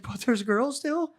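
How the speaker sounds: drawn-out hopeful question; mock spaced-out plea; imitating a dazed friend. Tripping friend asking again.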